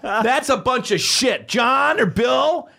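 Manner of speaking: imitated high, drawn-out protest; indignant complaint. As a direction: act out an outraged man appealing to his manager. Retold salesman complains to his manager.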